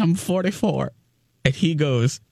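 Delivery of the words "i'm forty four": a slightly higher, sheepish confession of age; sheepish admission. Retold or imitated. Confessing her age to the young bartender, sheepish. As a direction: own up to your age, a bit embarrassed.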